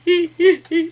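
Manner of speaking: high wet sob-laugh, still crying; teary sob-laugh. Retold or imitated. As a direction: let a tearful laugh break out. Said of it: "I'm, I'm just bawling".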